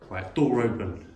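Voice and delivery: ordinary delivery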